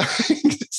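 high breathy laugh at the memory; breathless laugh. Real, not acted. Laughing while recalling how pissed the director was.

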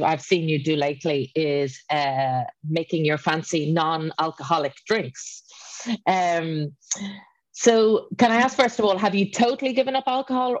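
ordinary delivery

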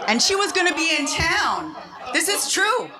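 high laughing voice, giggling through the words. Real, not acted. Teller laughing to the audience about her feng shui friend. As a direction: laugh it out, amused at the absurdity.

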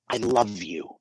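soft, tender "I love you"; loving tone. Shown as a demonstration. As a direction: say "I love you" gently, meaning it. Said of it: "the tone of our voice".

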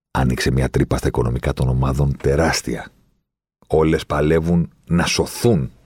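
usual speaking voice

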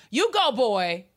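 high, loud sassy cheer; Gina voice. Retold or imitated. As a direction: shout it like Gina from Martin. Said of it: "Gina voice".

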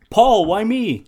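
high, loud mock plea; wailed, pitch leaping up; two-character movie quote. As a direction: cry it out like a hammy film line. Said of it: "these are two characters speaking".

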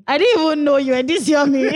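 high gushing delight; excited gushing. Retold or imitated. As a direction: gush it with delight. Gushing over the voice.

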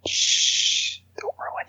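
unvoiced hushed whisper. Real, not acted. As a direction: hush them in a whisper. Hushing co-host.

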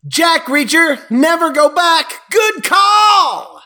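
shouted fake cheer for the sequel; mock hype. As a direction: hype the title like a trailer announcer. Mock trailer cheer at ranking.

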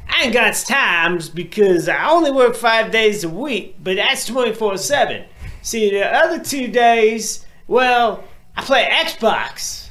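mock dumb poster. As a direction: play the clueless poster defending himself. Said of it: "voices the poster's excuse".

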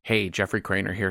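ordinary talk, nothing marked

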